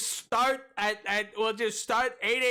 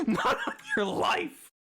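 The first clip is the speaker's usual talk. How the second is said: squeaky high disbelief; high laughing mock outrage. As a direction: shriek it out in disbelief. Repeats it high through laughter.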